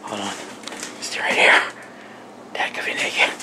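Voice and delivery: whispering. Real, not acted. Sneaking in on sleeping dad.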